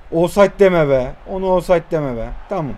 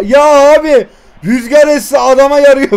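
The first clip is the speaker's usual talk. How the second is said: louder, higher outraged protest; loud complaint; 5 semitones higher, 8 dB louder than usual. Real, not acted. Gamer protesting the opponent's lucky goal, loud complaint. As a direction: shout at the unfair luck.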